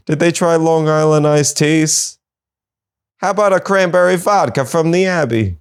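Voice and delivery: sassy bar-hopper delivery; campy quip. Acted. Host riffing on the bear, in a camp voice.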